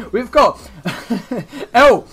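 laughing through the words. Real, not acted. Commentator cracking up mid-sentence about the fight, laughing. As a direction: try to carry on through a laugh.

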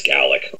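Gaelic with a short a, GAL-ik; Scottish pronunciation. Shown as a demonstration. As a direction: open Gaelic with the "gal" of Galway. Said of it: "it's pronounced Gaelic".

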